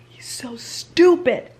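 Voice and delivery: slow annoyed whisper. Real, not acted. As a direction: hiss it under breath. Annoyed at character.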